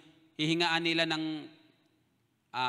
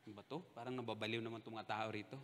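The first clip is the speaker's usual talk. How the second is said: puzzled muttering; 6 semitones lower, 14 dB quieter, faster than usual. Retold or imitated. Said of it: "Ako naman, sabi ko".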